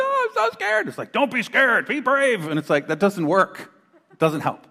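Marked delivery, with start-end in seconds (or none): 0.0-0.9 put-on very high, whiny little-kid squeak; a child telling a parent they're frightened; scared child voice. 1.1-2.5 mock parent advice. Parent brushing off kids' fear.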